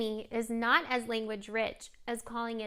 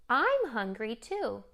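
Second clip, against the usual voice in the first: slow, with "I'm" pressed hard; "I'm" stressed so the child hears it; slower than usual. Shown as a demonstration. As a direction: lean into "I'm" to model it. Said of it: "Emphasizing on I".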